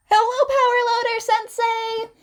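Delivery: high, loud bright hello; cheerful greeting. Acted. Students greeting their dazed teacher, high and loud.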